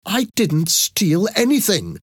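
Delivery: high-pitched, indignant protest; angry denial. A narrator's reading. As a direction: deny it hotly.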